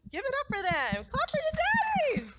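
high-pitched call for applause; excited, squealing crowd hype; rousing cheer. Real, not acted. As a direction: whoop up the crowd to clap.

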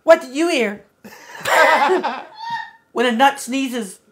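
put-on high-pitched Valley Girl parody. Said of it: "Read the joke in a Valley Girl voice".

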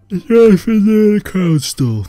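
sudden high loud question; excited. Real, not acted. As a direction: blurt it out, bright and loud.

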